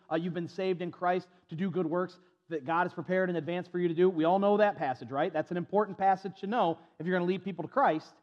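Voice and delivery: normal talking voice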